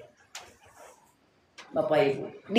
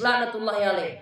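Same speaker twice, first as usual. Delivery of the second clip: ordinary talk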